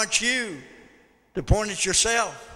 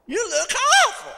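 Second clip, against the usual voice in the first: very high, loud exclamation; hammed-up horror, voice leaping way up; mock dismayed shout; 8 dB louder than usual. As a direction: wail it at a friend in mock shock. Repeats the tactless greeter.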